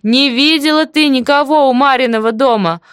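insistent high-pitched call; shout. A narrator's reading. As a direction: shout so a deaf neighbour hears. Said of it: "опять крикнула".

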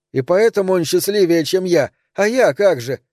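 put-on worked-up child demanding an answer; growing agitation. A narrator's reading. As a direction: plead with rising agitation. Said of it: "Эльза всё больше волновалась".

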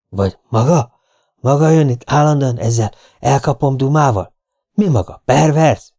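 testy man on the telephone; irritated client. Acted. Client regrets hiring a detective, irritated. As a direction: challenge your hired man down the line.

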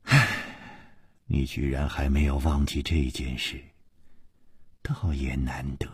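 low, soft, fading to a whisper; hushed, resigned remark; sighing concession. Acted. Sighing villainess concedes a point.

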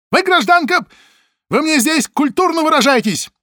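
very high, loud outraged yell; angry shout. Acted. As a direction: scream at her to behave. Чекист кричит. (гневный крик)

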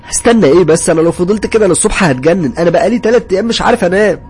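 loud, high, desperate protest; exasperated outburst. A narrator's reading. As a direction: snap back at the end of your rope. Sleepless husband refuses to wait. (انفعال ويأس بصوت عالي)

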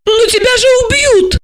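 very high, alarmed outcry; indignant protest. A narrator's reading. Asya objects to Gorokhov's reckless orders, high and indignant. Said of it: "возмутилась она".

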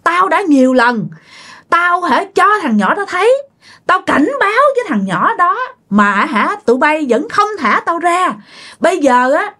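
put-on high, hoarse old ghost issuing death threats; threatening old man spirit. Spirit in the aunt threatening to kill the family, hoarse menacing voice. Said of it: "ông này nhập vô mợ nói vậy đó".

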